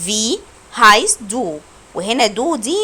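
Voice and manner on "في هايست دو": هايست drawn out slowly with a clear s; slow careful "vi haist du", s not b; a slow, clear model of هايست; slow German demo. Shown as a demonstration. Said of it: "انا نطقته زي الاس مش بي".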